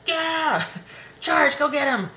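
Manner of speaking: high, loud rallying cry with a laugh; cheering angel. Retold or imitated. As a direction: urge them into battle, laughing. Angel card with raised fist.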